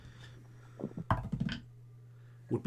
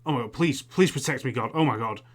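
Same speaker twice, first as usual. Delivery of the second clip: loud, panicked plea to God; acted shout of a man under attack; Samuel shouting; 8 dB louder than usual. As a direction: cry out for protection, voice raised. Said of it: "you hear Samuel shouting".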